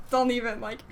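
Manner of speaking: ordinary delivery, nothing marked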